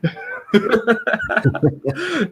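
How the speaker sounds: a high, relieved laugh. Real, not acted. Host laughing with relief that the call hasn't dropped. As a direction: laugh off the glitch, light and high.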